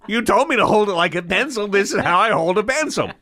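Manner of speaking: very high, rushed comic whine; acted indignant trainee; exasperated protest.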